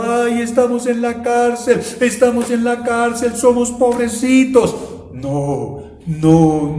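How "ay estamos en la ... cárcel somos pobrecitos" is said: higher, whiny self-pitying wail; hammed-up whimpering complaint; mock lament. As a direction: mimic a prisoner feeling sorry for himself. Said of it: "no estaban lamentándose, llorando".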